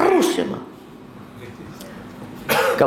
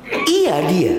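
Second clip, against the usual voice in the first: grudging reply; 7 semitones higher than usual. Retold or imitated. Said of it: "tapi intonasinya nggak enak".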